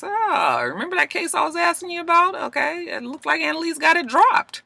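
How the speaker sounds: high, soft, fast gossipy tone; lightly voiced prying boss; nosy boss. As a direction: lean in nosily. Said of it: "and he says".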